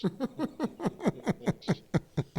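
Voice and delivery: a burst of real high laughter. Real, not acted. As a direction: break into a genuine laugh at the punchline. Laughs at his own punchline.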